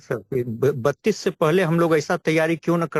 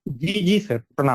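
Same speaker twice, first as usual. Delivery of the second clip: bad connection. Real, not acted. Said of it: "आपकी आवाज cut रही है".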